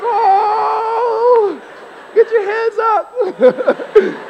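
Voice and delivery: mock church shout, very high and loud, drawn out slowly; church crowd cheering. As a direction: whoop like a fired-up congregation member. Congregation cheering the sermon.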